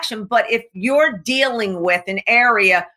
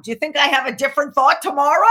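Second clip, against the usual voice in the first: ordinary delivery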